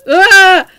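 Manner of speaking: loud high shriek of fright; startled scream. Acted. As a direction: yelp at the bugs. Player screams at bugs.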